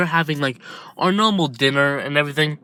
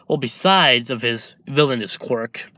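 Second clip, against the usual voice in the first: plain reading voice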